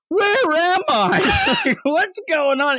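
very high, drawn-out bewildered voice; hammed-up daze, slow and high; confused patient. Mom waking in hospital, slow high mock confusion.

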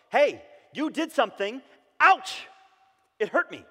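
hurt confrontation. Retold or imitated. Family member finally naming the hurt, loud and high. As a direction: let the ouch jump out. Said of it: "They can't acknowledge".

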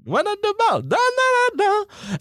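high sing-song ad jingle voice; mock advert jingle, high and bright; Old El Paso ad. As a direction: belt it like the cheesy TV ad, way up high. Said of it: "that Mexican Old El Paso ad".

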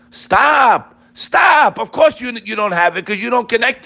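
high, forceful shout; shouted stop. Real, not acted. Preacher stopping complaining listeners, shouting.

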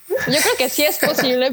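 giggling admission; laughing. Real, not acted. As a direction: laugh while you concede it. Laughing at own awkward idea.

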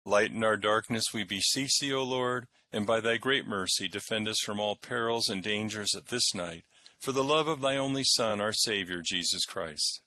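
solemn prayer voice; liturgical prayer. Acted. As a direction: lead the prayers in church. Intones versicles and collects.

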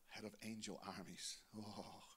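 soft, whispered prayer to the Lord; hushed prayer. Acted. Pastor praying softly over the congregation, hushed. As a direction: pray quietly, almost under the breath.

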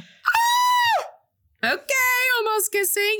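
high, loud delighted shriek; excited squeal. Real, not acted. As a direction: squeal over the almost-kiss. Reacting to the kiss.

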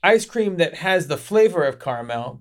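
ordinary talk, nothing marked

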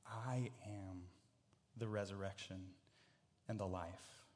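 soft, low and spaced out; quiet, solemn scripture reading with weighty pauses; hushed declaration. A narrator's reading. Reading Jesus' solemn declaration to Martha.

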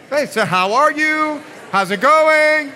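high, loud small talk; loud chatting. Pretend. Preacher greeting neighbour amid noisy congregation, loud chatting. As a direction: call out a greeting over the chatter.

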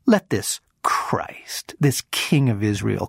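slow, sneering whispered taunt; mocking whisper. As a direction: hiss the title with contempt. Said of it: "mocked him among themselves".

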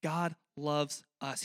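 usual speaking voice

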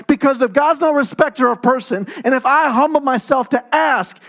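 rising, emphatic sermon climax; preaching at full force. Acted. Pastor stirring the congregation, building fervour. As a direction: drive the point home to the church.

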